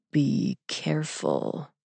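very slow, soft warning; slow, quiet menace from an oracle; ominous eight ball. A narrator's reading. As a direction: warn softly, drawing each word out.

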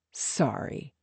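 soft, near-whispered aside; quiet apology. A narrator's reading. Actor to his friend after fans leave, quiet apology. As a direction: drop it low, just for your friend.

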